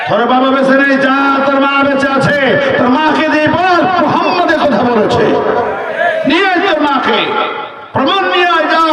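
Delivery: high, ringing sermon delivery; preaching at full force. Acted. Preacher raising his voice to the crowd, fiery sermon. Prophet sends him to mother.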